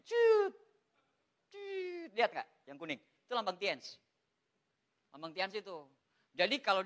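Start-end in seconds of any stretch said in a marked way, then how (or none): 0.0-2.1 very high, short squeaked zap; high-pitched "ciut" sound effect; imitated laser sound. Shown as a demonstration. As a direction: mimic a laser pointer with your mouth. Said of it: "Soalnya nggak ada lasernya nih".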